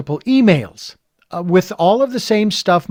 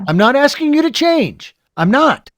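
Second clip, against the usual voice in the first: pleading emphasis; 9 semitones higher, 5 dB louder than usual. Acted. Imagined talk to the angry man.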